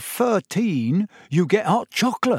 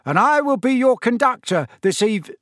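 high, loud conductor's patter; loud announcement; 9 semitones higher, 4 dB louder than usual. A narrator's reading. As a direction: call out like a conductor greeting passengers. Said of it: "began to speak loudly to the night".

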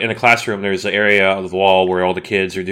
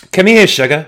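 campy come here sugar; 7 semitones higher, 6 dB louder than usual. Acted. Campy greeting to cohost.

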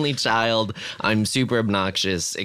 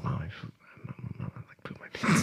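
whisper; 6 semitones lower, 9 dB quieter than usual. Retold or imitated. Child confessing to mom.

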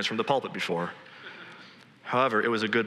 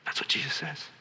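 ordinary talk

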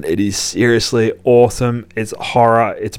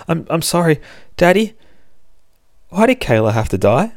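put-on little girl's voice; 9 semitones higher than usual. Child Lucy answers her parents.

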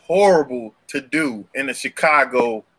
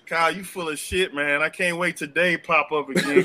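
ordinary talk, nothing marked

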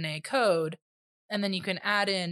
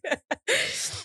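laughing; 12 semitones higher, 5 dB louder than usual. Real, not acted. Laughing at the joke.

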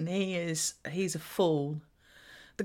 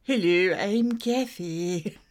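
mock Kathy impression; 4 semitones lower, slower than usual. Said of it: "my voice never changes".

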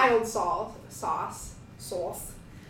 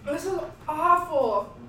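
high, laughing through tears; tearful laugh from the burn; crying; 7 semitones higher, much slower than usual. Real, not acted. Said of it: "I'm crying".

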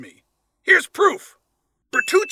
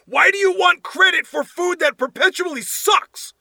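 exasperated outburst; 8 semitones higher than usual. Real, not acted. Ranting at reviewer's logic.

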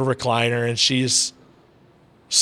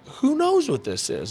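high, slow, wondering; wondering aloud; 12 semitones higher than usual. Real, not acted. Telling the host about grandma, wondering aloud. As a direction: let it float up in wonder.

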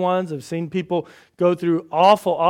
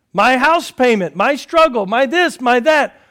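mock complaint; 7 semitones higher, 8 dB louder than usual. Preacher voices a complaining listener.

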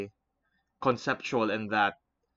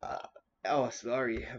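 while sipping a drink. Real, not acted. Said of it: "I'm drinking orange juice".

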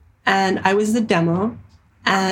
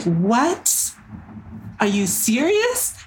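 higher, slow incredulous what, are you serious; retold disbelief; 3 semitones higher, much slower than usual. To her colleagues, hearing she is deadpan, stunned and incredulous.

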